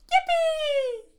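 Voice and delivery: very high shouted yippie; overjoyed child shout; gleeful cheer. Acted. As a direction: squeal with delight as a little girl. Girl cheers at her turn.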